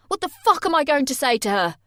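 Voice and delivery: high, loud furious question; panicked fury. Acted. Jay panicking about what to tell his mum, furious. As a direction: rage in a panic.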